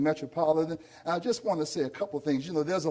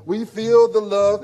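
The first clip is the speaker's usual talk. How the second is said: slow, much louder final call; loud slow emphatic; 3 semitones higher, 11 dB louder, slower than usual. Real, not acted. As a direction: belt it out slowly. Repeated chant to congregation.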